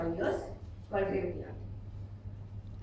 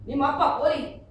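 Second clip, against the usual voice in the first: louder, imitating a son's announcement; retold son; 2 semitones higher, 8 dB louder than usual. Son telling parent news, imitated announcement. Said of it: "my son came to me and said".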